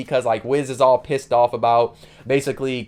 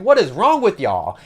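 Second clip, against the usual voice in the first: slow, higher-pitched exasperated question; fed up, voice raised in pitch; 5 semitones higher, 3 dB louder, slower than usual. Retold or imitated. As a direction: draw it out in mock disbelief. Duke's acted exasperation.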